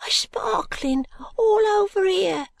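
put-on high, hushed ragged boy; street urchin whisper. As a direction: breathe it like a frightened street boy.

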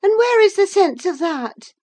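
high, loud, peevish challenge; petulant girl's retort. A narrator's reading. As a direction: throw the question at him crossly, pitched high. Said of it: "in a peevish tone".

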